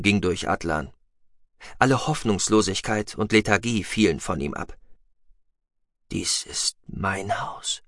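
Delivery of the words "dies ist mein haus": slow, soft awed whisper; hushed, reverent murmur. A narrator's reading. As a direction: breathe it out slowly in wonder. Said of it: "flüsterte er".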